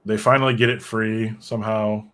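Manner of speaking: ordinary talk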